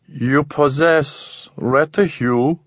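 very slow, alien, not asking; alien statement. A narrator's reading. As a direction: state it flatly, creature-like. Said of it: "it was not a question this time".

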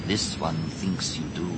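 low, soft character voice; Tizar's voice. Acted. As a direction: speak low and softly in character.

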